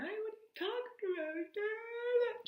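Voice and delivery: high, drawn-out mock wail; fake crying. Daughter told she is staying overnight, fake crying. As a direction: ham up a sobbing meltdown, stretching the last words. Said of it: "Just kidding. I didn't cry once".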